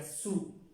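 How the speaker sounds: su as one open syllable; demonstrated pronunciation. Shown as a demonstration. Teacher showing students how sous sounds, careful pronunciation. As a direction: say "su" as a clear French model word. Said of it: "esta se pronuncia su".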